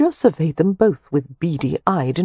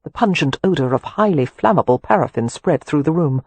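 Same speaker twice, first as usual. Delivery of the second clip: ordinary delivery, nothing marked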